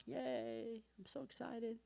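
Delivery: high, soft mock cheer; a quiet, high little celebration. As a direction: squeak out a small happy yay. Mock happy at son's cry.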